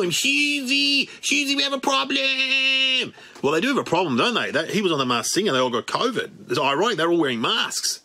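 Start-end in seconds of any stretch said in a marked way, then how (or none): 0.0-3.1 higher, slow announcer call; mock announcer. Comic introduces friend Shizzy in a hammed-up announcer voice. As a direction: drawl the name like a game show host.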